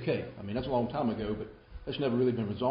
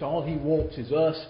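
ordinary talk

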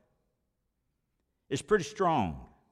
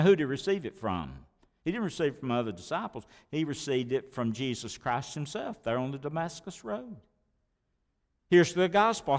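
normal talking voice

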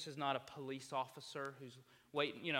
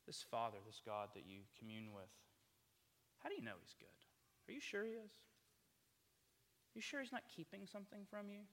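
insinuating serpent; 5 semitones higher, 6 dB quieter than usual. Acted. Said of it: "the serpent ... says".

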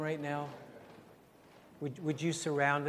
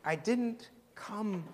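voice of the Lord; 6 semitones higher, much slower than usual. Acted. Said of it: "I sense the Lord is saying".

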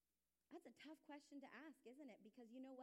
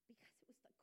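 real whisper; 10 dB quieter, much faster than usual. Real, not acted. Moved while recalling crying.

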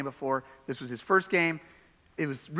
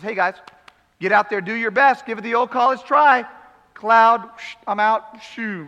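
mock breezy send-off; 4 semitones higher, 7 dB louder than usual. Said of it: "if all Jesus had to offer them was".

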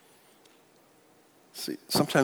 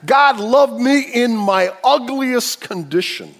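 higher, louder, ringing preacher's cry; preaching at full force; 9 semitones higher, 8 dB louder than usual. Acted. Preacher to congregation, full-force preaching. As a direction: lift the room with it, stretching the last line.

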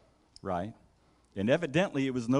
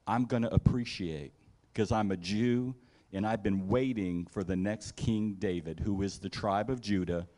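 normal talking voice

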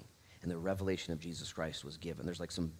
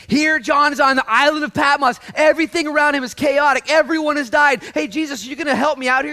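very loud, high-pitched proclamation; shouted preaching; 19 dB louder than usual. Real, not acted. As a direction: shout the story to the congregation.

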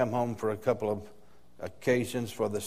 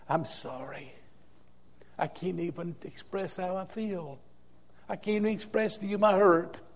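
grieving apology; 7 semitones higher than usual. Retold or imitated. Pastor grieving at a funeral.

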